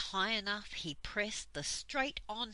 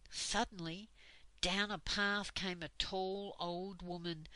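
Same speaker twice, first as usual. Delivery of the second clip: ordinary delivery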